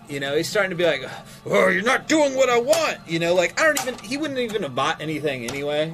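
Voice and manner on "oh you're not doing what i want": high, loud whining customer outburst; higher, louder, mock-outraged protest; mock tantrum. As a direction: imitate a customer throwing a fit. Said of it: "he's starting to kinda throw a fit".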